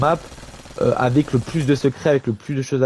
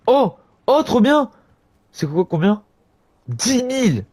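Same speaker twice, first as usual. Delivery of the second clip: excited; 10 semitones higher, 3 dB louder than usual. Real, not acted. Excited by a new item.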